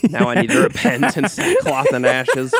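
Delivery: laughing mock penitence. Real, not acted. Host teased for grumbling, laughing as he pleads guilty. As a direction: talk through real laughter.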